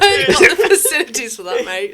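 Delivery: high, loud, helpless laughter; really laughing while talking. Real, not acted. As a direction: crack up through the words. Echoes the joke, cracking up.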